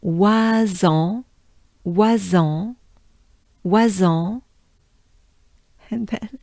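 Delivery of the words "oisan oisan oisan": "oisan" as wa-zan, nasal end; French pronunciation. Shown as a demonstration. Teacher drilling "oisan" for learners. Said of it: "So wa and en".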